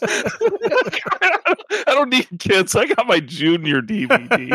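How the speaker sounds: high-pitched, laugh-filled declaration; laughing mock boast. Co-host jokes about replacing kids, laughing high voice. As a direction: crack up while bragging in jest. Joking through group laughter.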